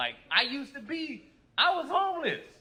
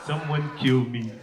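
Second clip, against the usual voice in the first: defeated husband; 9 semitones lower than usual. Retold or imitated. Husband in grocery store mutters to onlooker, low and hopeless. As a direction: sound utterly crushed and resigned. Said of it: "he just goes".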